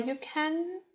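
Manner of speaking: ordinary talk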